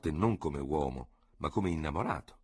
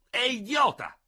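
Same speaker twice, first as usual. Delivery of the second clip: "idiota" flung out very high and louder, falling hard; outraged outburst; 5 dB louder, slower than usual. A narrator's reading. Ferraud calling the situation idiotic, outraged and loud. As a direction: spit out "idiota" in high indignation. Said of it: "con accenti di profonda e sbigottita convinzione".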